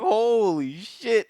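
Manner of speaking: drawn out, slow amazement; slow stunned holy shit. Real, not acted. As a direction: let it out slowly in awe. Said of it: "reacting to scene".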